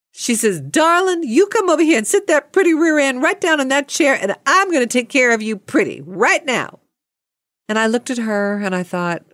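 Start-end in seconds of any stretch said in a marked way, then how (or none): 0.6-6.8 high, gushing take-charge voice; high-pitched, emphatic coddling; motherly saleswoman. Retold or imitated. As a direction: imitate a doting saleswoman bossing someone kindly. Acted saleswoman taking charge kindly.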